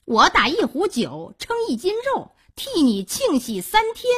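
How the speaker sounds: high, mock-celebratory jeer; high-pitched, swooping sarcasm; spiteful taunting. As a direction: taunt the bereaved with false cheer. Xiao'e taunts the bereaved man.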